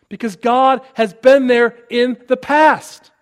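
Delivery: slow, loud and emphatic; forceful preaching. Real, not acted. Preacher to the church, slow and forceful. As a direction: drive the words home from the pulpit. Preacher driving his point home.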